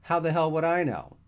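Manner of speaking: high, loud protest; exasperated outburst. A narrator's reading. Dan fed up with his friend's muddled denials, exasperated outburst. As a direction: burst out, thoroughly exasperated.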